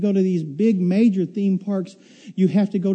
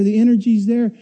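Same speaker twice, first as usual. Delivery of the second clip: ordinary talk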